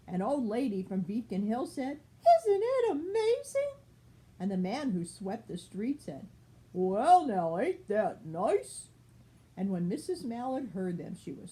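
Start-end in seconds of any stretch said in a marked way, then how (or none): 2.4-3.8 high, loud, quavering delight; amazed old lady. Acted. Old lady from Beacon Hill marvels at ducks, high and amazed. 6.7-8.9 loud drawn-out well, slow drawl; folksy street sweeper. Acted. Street sweeper admires the duck parade, slow folksy drawl.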